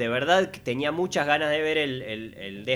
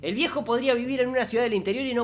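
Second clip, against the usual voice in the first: pitched-up comic rant; high exasperated rant; 9 semitones higher than usual. Acted. Exaggerated rant about old man.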